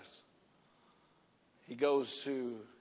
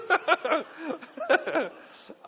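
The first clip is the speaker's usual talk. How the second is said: long loud laugh; 8 dB louder than usual. Real, not acted. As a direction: keep laughing at the joke. Keeps laughing.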